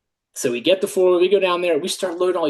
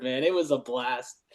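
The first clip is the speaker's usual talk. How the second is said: ordinary delivery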